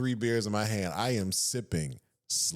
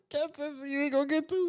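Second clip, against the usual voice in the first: high laughing jab; laughing tease; 4 dB quieter than usual. Real, not acted. Laughing while teasing big bro about one drink.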